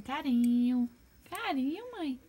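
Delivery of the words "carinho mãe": high cooing pet voice; high baby voice calling it "neném"; baby talk to pet. Acted. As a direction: sweetly coax the bird. Cooing to the bird.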